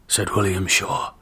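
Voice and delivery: plain reading voice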